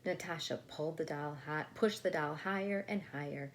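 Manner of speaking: usual speaking voice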